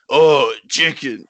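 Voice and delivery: slow, drawn-out dopey repetition; mock slow-witted parroting of the last word; dim echo. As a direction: stretch it out, playing confused. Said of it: "he just says the last thing that you said".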